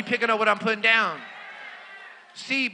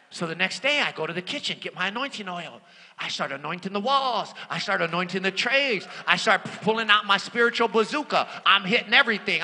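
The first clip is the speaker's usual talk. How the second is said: ordinary talk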